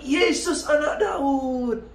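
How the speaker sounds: high called-out cry, drawn slow; calling out. Retold or imitated. Blind beggar cries out to passing Jesus, high call. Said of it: "Dia teriak".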